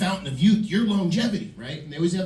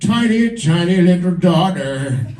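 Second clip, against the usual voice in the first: slow, hammed-up British nature narrator; David Attenborough; 3 semitones higher, slower than usual. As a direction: marvel at the wildlife as a documentary narrator, stretching the words. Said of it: "you know his voice".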